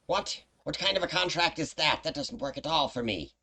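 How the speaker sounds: high-pitched imp, affronted; indignant imp. Acted. Game master's imp rejects the player's contract, indignant. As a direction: protest as a put-upon little imp.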